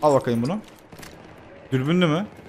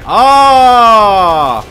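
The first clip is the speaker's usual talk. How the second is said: a long, high, much louder shout; loud battle yell; 6 semitones higher, 12 dB louder than usual. Real, not acted. Charging at the enemy soldiers with a long yell. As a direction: let out a charging war cry.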